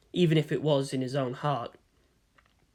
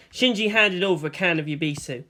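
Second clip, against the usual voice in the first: ordinary delivery, nothing marked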